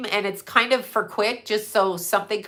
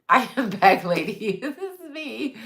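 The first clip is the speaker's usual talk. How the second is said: laughing; 3 semitones higher than usual. Real, not acted. Laughing self-introduction on livestream.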